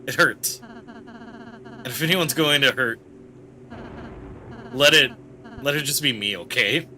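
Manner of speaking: gentle, hesitant character voice, close to tears by the end; Ralsei confessing. Acted. Ralsei admits to Susie that knowing things hurts, hesitant and pained. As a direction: confess haltingly, with understated hurt.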